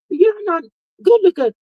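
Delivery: fly up high, fed up with the viewers; exasperated outburst. Real, not acted. Scolding viewers who won't engage.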